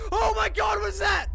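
high, loud shriek of fright; terrified shout. Real, not acted. Player seeing eyes in the dark, screaming.

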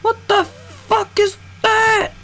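high, slow, disbelieving squeal; shocked. Real, not acted. Spotting the monster, a slow high shocked squeal. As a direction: stretch it out in shock.

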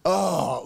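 drawn-out groan of dread; audience groan. Retold or imitated. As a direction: groan as if here he comes again. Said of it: "we would start groaning".

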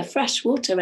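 ordinary delivery, nothing marked

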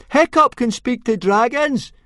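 slow, high-pitched full shout; very loud shout. Acted. Fishlegs announces his friend to the whole crowd, shouting. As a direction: bellow it over the crowd as a boy. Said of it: "shouted Fishlegs very loudly indeed".

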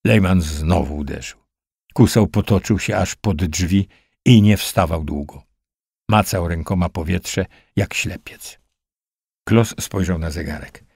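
ordinary delivery

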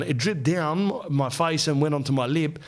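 ordinary talk, nothing marked

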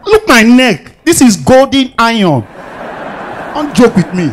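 high, slow mock big-boy boast; big boy swagger. Comedian bragging to the audience as a big boy, high and slow. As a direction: show off like a flashy big boy. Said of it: "I'm a big boy".

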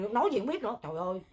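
ordinary talk

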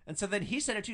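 ordinary delivery

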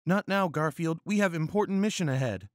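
high-pitched, hurried female character voice; blushing first officer. Acted. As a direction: brush him off in a high put-on voice. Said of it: "face blushing with rainbow colors".

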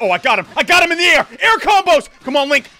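high, loud victory yells; real victory shout; triumphant shouting. Real, not acted. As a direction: scream yes in triumph. Finishes off the boss.

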